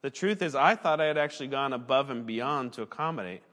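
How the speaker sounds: ordinary delivery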